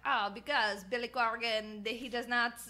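hammed-up French tough talk; French accent. Host mimicking the Tribunal's backstage boast, mock French accent. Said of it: "a pretty good French accent".

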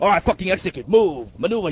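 loud barked command to move; barked order. Acted. Drill sergeant sending the recruits running, loud bark. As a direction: drive them out the door.

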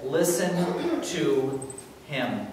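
soft, slow, solemn command; hushed divine voice. Retold or imitated. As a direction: let it fall quiet and heavy. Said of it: "The voice said".

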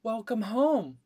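mother's voice, high and loud. A narrator's reading. Mother welcoming daughter home, high and loud. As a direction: greet her warmly as mother. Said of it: "said her mother".

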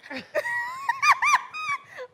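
high shrieking burst of laughter. Real, not acted. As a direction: explode into a squealing laugh.

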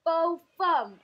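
high, very loud storybook yell. Acted. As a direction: yell it so everyone can hear. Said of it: "it yelled".

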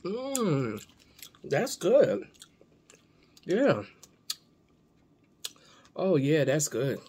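speaking between bites; chewing while talking. Real, not acted. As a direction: mouth partly full of food. Seen 1: Bite taken at -3.0; pursed, chewing mouth through the labelled words.

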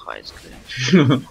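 put-on low, slow pseudo-Spanish accent; mock Spanish drawl on gelernt; fake foreign language. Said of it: "Du bist immer so Pseudospanisch".

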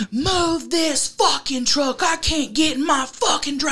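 high, drawn-out yell without full volume; high-pitched mock screaming rant. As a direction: imitate an irate neighbor yelling, held back on volume. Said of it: "screaming at the top of his lungs".

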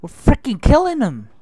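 high, loud cry of disbelief; shocked outcry. Real, not acted. Player realizing they are killing someone, shocked outcry. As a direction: cry it out, pitch leaping up.

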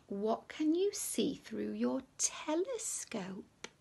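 put-on slow hushed whisper to the children; story whisper. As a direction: whisper it like a secret. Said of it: "reading to children".

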